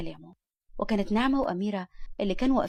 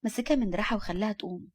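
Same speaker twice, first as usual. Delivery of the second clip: usual speaking voice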